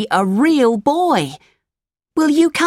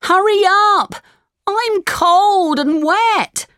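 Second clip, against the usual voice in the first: put-on shouting puppet boy; slower than usual. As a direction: yell it, cold and wet. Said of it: "shouted Pinocchio".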